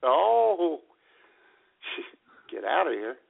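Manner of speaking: ordinary delivery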